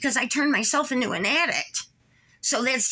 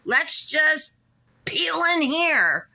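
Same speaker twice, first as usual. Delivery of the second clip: higher-pitched mocking voice; mock a careless driver's thought, pitched up; sarcastic imitation of a reckless driver; imitated careless driver; 7 semitones higher, slower than usual. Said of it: "and then they thought".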